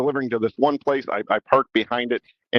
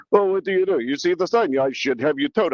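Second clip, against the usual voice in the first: put-on old man's European accent; 4 semitones higher than usual. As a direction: play the fussy old man scolding in his accent. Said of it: "a little European accent".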